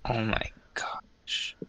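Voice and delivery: slow, soft whispered amazement; drawn-out breath of awe; awed whisper. Real, not acted. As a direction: whisper it slowly in disbelief. Amazed reaction to cute pet.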